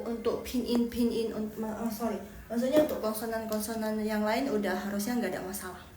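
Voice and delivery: usual speaking voice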